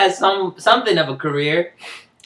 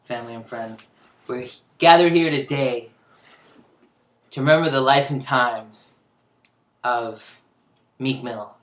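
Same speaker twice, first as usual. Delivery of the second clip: faltering tender eulogy; 5 semitones lower, 7 dB quieter than usual. Pretend. Mock funeral eulogy opening.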